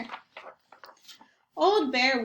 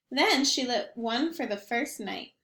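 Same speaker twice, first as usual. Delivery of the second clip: ordinary delivery, nothing marked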